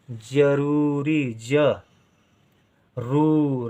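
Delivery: जरूरी stretched out slowly, each syllable apart. Shown as a demonstration. Teacher to pupil, slow dictation of जरूरी. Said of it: "रा के साथ ऊ का उच्चारण".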